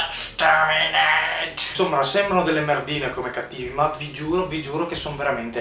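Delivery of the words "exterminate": slow, drawn-out robotic screech; Dalek robot voice. Retold or imitated. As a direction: grind it out like a killer robot. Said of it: "con una voce robotica".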